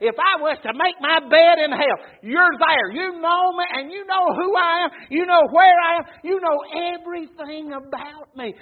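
loud, high impassioned preaching; preaching at full force. Acted. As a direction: proclaim David's words to the church. Preaching David's words.

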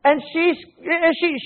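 ordinary talk, nothing marked

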